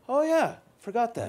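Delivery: high, offhand "oh yeah, forgot that"; dismissive quote voice. Retold or imitated. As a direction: toss it off like it's nothing. Quoting dismissive people.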